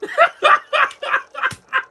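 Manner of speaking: loud, high laughing outburst; real laughing shout; bursting out laughing. Real, not acted. Laughing at the scene.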